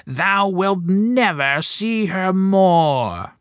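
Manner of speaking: high, slow taunting witch voice; mocking witch taunt. A narrator's reading. Enchantress taunts the king's son in the tower, high slow mocking witch voice. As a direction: gloat over him slowly, high and cruel. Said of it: "she cried mockingly".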